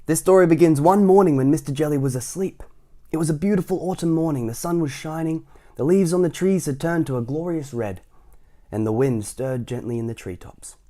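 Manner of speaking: put-on bright, lively read-aloud voice for children; story narration. A narrator's reading. Reading a story aloud to a child, lively and bright. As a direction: read it like a bedtime picture book.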